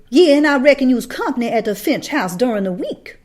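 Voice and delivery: loud, high sneering jab; a hostile woman taunting Calpurnia. A narrator's reading.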